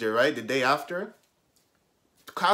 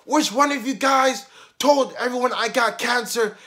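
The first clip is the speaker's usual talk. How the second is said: high, loud accusing mock-teacher voice; imitated angry teacher; 8 semitones higher, 6 dB louder than usual. Teacher confronting the class over a rumor, accusing imitation. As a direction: demand it loudly, pitched up.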